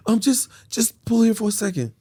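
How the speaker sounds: high, hesitant request; high mock girlfriend; imitating woman friend. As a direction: ask haltingly in her high voice. Voices woman directing him to pull over.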